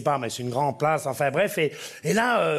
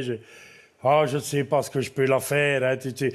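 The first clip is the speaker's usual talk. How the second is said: put-on old farmer; 4 semitones lower than usual. Old farmer complaining to a buyer about his cow. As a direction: mumble like a weary old farmer. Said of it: "il est là, il fait".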